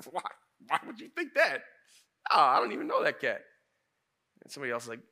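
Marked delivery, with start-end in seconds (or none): 0.1-3.4 high, stammering mock denial that drops and gets louder; defensive Peter. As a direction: act out someone caught and protesting. Peter acted denying he knows Jesus.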